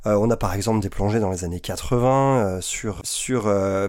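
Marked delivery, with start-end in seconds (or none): none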